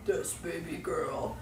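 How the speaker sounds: slow, lightly put-on seducer voice; hint of a fake smooth talker; mock smooth guy. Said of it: "He speaks like".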